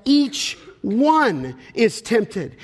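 EACH and ONE slow and set apart; stress on EACH ONE. Real, not acted. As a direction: lean on "each", pause, then "one". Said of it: "[name] is stressing the universal experience".

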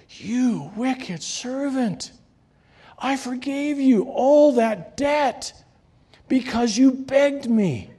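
slow, wounded anger from the king; stern rebuke. Acted. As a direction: master confronts the unforgiving servant, indignant. Master rebuking the wicked servant.

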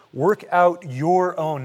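"own" slowly stretched and leaned on; stress on own. Shown as a demonstration. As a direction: draw out the word "own" to make the point. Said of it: "But notice what he says here".

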